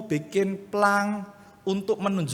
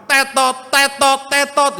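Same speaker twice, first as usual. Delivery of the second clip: "tetot" blared higher and louder like a buzzer; loud beep imitation; 9 semitones higher, 10 dB louder, slower than usual. Pastor showing how the loud crossing beep disturbs chapel, blaring. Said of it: "bunyinya banter".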